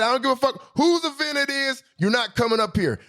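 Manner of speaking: high, squeaky mock of the guard's refusal. Retelling the guard's refusal to the audience, high mocking squeak. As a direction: squeak it out, making fun of him.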